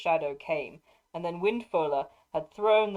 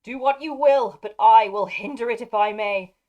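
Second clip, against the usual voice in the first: defiant shout; 5 semitones higher, 10 dB louder than usual. Acted. Dernhelm defies the wraith.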